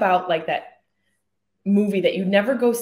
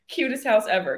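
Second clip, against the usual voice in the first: ordinary talk, nothing marked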